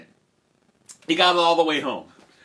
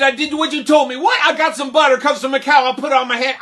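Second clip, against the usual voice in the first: loud, high, rushed protest; defensive boy Jack; 13 semitones higher, 10 dB louder, faster than usual. Acted. Jack explaining the milk to mother, defensive. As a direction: blurt out your excuse at speed.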